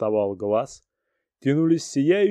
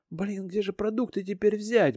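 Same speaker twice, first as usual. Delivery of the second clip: бормотание Риты; 9 semitones higher, 4 dB quieter than usual. Acted. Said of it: "бормотала Рита".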